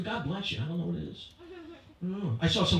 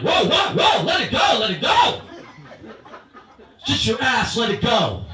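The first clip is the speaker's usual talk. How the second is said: loud placating whoa; 4 semitones higher, 7 dB louder, slower than usual. Retold or imitated. Husband backing down from fight.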